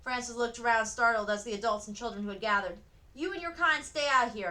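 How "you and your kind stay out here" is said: higher, louder hostile shout; man shouting. A narrator's reading. Man in the crowd shouting at Frances, hostile shout. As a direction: shout her out of the street. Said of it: "a man shouted".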